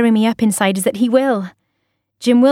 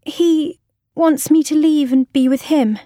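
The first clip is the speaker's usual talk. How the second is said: forced out through tears; 6 semitones higher than usual. A narrator's reading. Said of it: "I can hardly force the words out".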